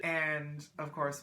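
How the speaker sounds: normal talking voice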